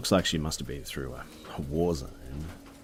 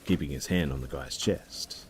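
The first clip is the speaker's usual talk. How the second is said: ordinary delivery, nothing marked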